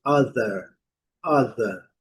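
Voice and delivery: other said adɐ with a short A-like first vowel; English other. Shown as a demonstration. Teacher modelling other for Brazilian students. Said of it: "a pronúncia da letra O e também o T H".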